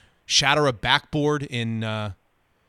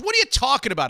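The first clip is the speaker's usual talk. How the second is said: angry retort; 10 semitones higher, much faster than usual. Retold or imitated. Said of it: "he says".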